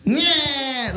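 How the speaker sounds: high, loud mock peekaboo "nyeh"; peekaboo squeal. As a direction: pull a face and squeal it at a baby. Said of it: "Like, just".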